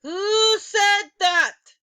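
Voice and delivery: slow, high, loud furious demand; snapped. A narrator's reading. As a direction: spit out each word in fury. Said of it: "she snapped".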